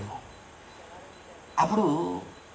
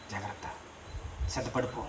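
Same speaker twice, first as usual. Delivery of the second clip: hushed warning; 11 semitones lower, 19 dB quieter than usual. Acted. Preacher repeats the warning, hushed.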